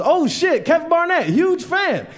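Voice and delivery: high-pitched, excited fan impression; starstruck fan. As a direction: gush on spotting your favorite comic. Acts out fan recognizing him.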